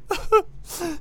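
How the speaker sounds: falsetto mock sob-laugh; high wailing laugh; high crying laugh. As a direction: squeal a mock wail. Mocking the eulogy.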